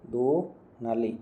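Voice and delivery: "दुनाली" split slowly as "du... naali"; slow syllable demo. Shown as a demonstration. Teacher breaks up the word for students, slow and separated. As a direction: draw out the first syllable of "दुनाली", then "naali". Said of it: "दो का दो बन जाता है".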